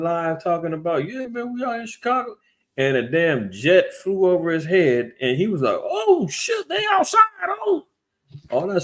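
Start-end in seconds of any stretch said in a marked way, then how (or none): 1.1-2.4 mock bragging. Jake bragging on his live stream. 5.8-7.9 high, panicked yelp imitating a scared man; mock Jake as the jet roars over, squealing; startled panic. Streamer caught off guard by a jet overhead, panicked high squeal.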